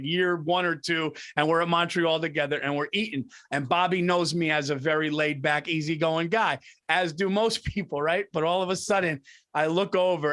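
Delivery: ordinary talk, nothing marked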